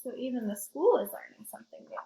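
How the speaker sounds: ordinary delivery, nothing marked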